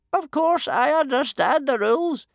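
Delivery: very high, loud, unruffled official; calm chief judge. A narrator's reading. Judge answering Stoic on the rules, calm high voice. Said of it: "said the Chief Judge calmly".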